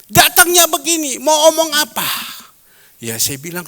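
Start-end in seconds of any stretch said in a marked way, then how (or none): 0.1-2.1 put-on elderly friend pleading about her driver; old friend's complaint. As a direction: play the aggrieved elderly friend. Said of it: "dia bilang".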